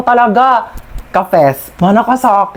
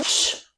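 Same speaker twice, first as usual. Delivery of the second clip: sharp shush; 14 dB quieter than usual. Acted. Hushing a noisy friend.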